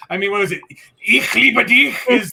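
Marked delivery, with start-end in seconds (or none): 1.1-2.0 raised, louder-edged harsh German love line; mock German yelling. Shown as a demonstration. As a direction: say "Ich liebe dich" like an invader's order. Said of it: "sounding like you're yelling at someone".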